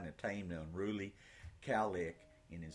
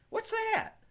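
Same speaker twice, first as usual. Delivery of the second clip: put-on boy's voice; 7 dB louder than usual. Boy Ben asks.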